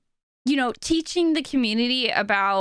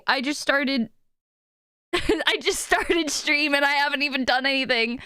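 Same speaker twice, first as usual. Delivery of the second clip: ordinary delivery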